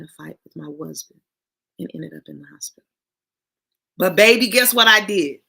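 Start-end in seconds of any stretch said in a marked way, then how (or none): 4.0-5.4 much louder, bright proud tease; triumphant tease. Real, not acted. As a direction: build up the big reveal. Triumphant reveal.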